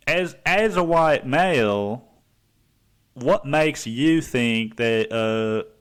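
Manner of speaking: hammed-up counsellor impression, pausing heavily; Doctor Phil. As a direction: question them like a drawn-out talk-show host. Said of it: "my Doctor Phil impression".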